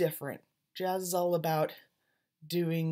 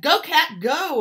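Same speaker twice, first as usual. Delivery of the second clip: high, loud cheer of the club cats; crowd cheering; 10 semitones higher, 12 dB louder than usual. Acted.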